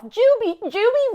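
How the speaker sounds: high, loud, desperate calls; child screaming. Retold or imitated. Little sister screaming for the older one to grab them. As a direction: scream the name high, pleading for rescue. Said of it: "screaming her head off".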